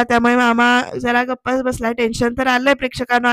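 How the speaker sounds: ordinary talk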